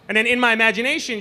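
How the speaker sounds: ordinary delivery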